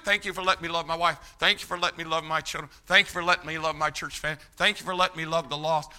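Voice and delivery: rapid-fire thanks. Acted. Believer thanking God for wife, children, church, rapid-fire thanks. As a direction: rattle off the thanks in one breathless list.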